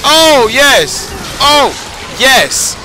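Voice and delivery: excited yes. Real, not acted. Gamer finally solving the boss fight, excited. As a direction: celebrate finding the answer. Finally found the right move.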